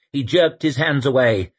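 ordinary delivery

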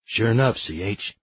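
rough, scratchy assistant voice; raspy voice. Acted. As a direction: rasp it out. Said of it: "came the raspy voice".

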